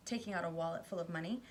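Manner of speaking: ordinary delivery, nothing marked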